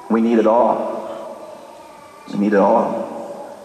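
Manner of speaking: slow, weighty delivery; drawn-out, sombre admission; grave doctor. Acted. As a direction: break hard news slowly. Doctor breaks grave news slowly.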